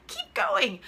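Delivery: high encouraging push. Shown as a demonstration. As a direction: cheer herself on to keep going.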